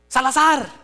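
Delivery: loud stern call of a surname; grito de fraile. Retold or imitated. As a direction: bark the name like a scolding friar. Fraile grita el apellido. (grito severo llamando)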